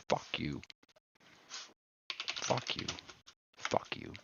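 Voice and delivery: breathy whispered curse. Real, not acted. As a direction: hiss it under your breath. Repeated muttered insult.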